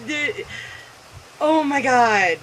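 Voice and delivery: normal talking voice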